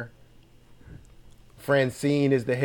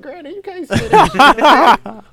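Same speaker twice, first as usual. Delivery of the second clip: laughing protest; 13 semitones higher, 9 dB louder, slower than usual. Real, not acted. Scolding granny, laughing.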